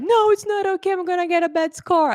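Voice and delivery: very high, whiny imitation of a tearful student; mock crying. Student protesting to teacher about the test, mock crying. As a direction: wail in a high voice, imitating someone upset. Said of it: "she started crying".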